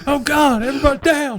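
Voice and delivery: high, loud hammed-up panic; mock panic. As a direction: shout for everyone to get down.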